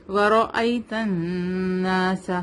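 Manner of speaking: ورأیت الناس slowly, n of الناس held in the nose; ghunna. Shown as a demonstration. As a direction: hum the doubled ن of الناس before releasing. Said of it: "نون پر ... غنہ". (الناس کے نون پر غنہ)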